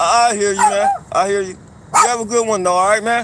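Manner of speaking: loud, curt brush-off; loud call-out. Real, not acted. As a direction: shout a dismissive goodbye. Dismissing a stranger loudly.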